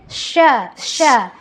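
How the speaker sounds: "Sha" with a strong hissed SH; "Sha" with a long, forceful hush at the start; strengthened SH. Shown as a demonstration. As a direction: push harder on the SH of "Sha".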